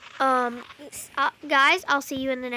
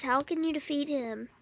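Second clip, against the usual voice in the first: usual speaking voice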